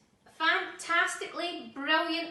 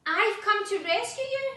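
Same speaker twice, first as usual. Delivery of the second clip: higher, louder, proud hero voice; 7 semitones higher, 8 dB louder than usual. A narrator's reading. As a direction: announce the rescue with chest out. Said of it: "Marmaduke said proudly".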